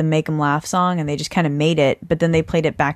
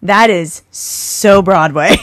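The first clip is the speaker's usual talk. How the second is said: slow and louder, theatrical; mock Broadway flourish; 7 dB louder, much slower than usual. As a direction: drawl it like a diva. Hamming up Broadway style.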